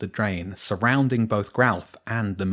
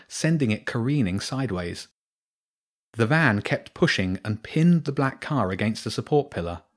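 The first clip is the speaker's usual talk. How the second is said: usual speaking voice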